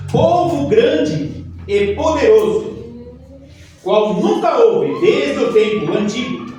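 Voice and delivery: loud, slow, fervent Bible reading; preaching scripture. Acted. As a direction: proclaim it like a prophet. Reads scripture with fervour.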